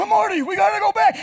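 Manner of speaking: high, loud, frantic movie scientist; hammed-up manic impression, urgently calling out; wild-eyed inventor's panicked cry; Doc from Back to the Future. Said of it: "remember Doc from".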